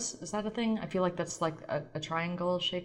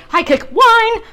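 much higher, loud show-name shout; hyped shout; 17 dB louder, slower than usual. Acted. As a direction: yell the catchphrase like a host.

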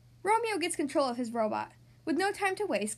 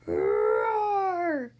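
dinosaur roar; 7 dB louder than usual. Acted. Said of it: "says the dinosaur".

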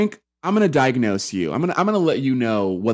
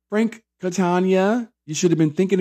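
calling him out; 7 semitones higher than usual. Real, not acted. Calling Frank out.

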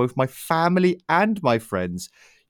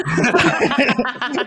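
high laugh; 11 semitones higher, 4 dB louder than usual. Real, not acted. Laughing after voice crack.

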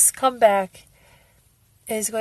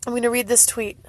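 usual speaking voice

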